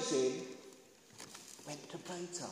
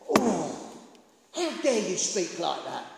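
lower-pitched pious indignation; shocked religious person; 7 semitones lower, 5 dB louder than usual. Acted. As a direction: scold like an offended churchgoer. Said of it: "if you was a religious person".

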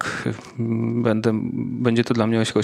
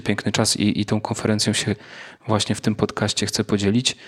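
ordinary talk

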